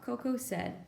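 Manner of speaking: ordinary delivery, nothing marked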